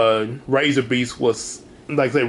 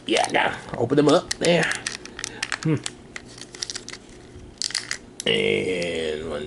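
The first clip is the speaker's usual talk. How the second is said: ordinary talk, nothing marked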